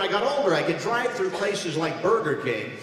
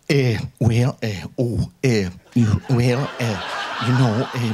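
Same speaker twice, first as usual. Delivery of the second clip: lower, halting ums and ehs; Reagan impression; 7 semitones lower, 3 dB louder than usual. President stalling with sounds instead of words. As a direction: hem and haw like the old president. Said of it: "Reagan was great to do".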